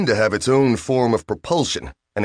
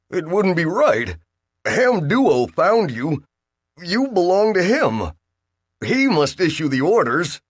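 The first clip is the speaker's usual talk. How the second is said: higher, halting pleading voice; nervous Bill; 9 semitones higher than usual. Acted. Bill nervously telling the machine it belongs to Duo. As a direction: plead weakly, high and hesitant.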